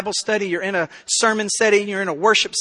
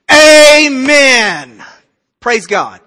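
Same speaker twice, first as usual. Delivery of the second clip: loud, high, drawn-out Amen; exultant amen; 4 semitones higher, 10 dB louder, much slower than usual. Acted. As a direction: shout it out like praise in church. Delighted reply to hurt listener.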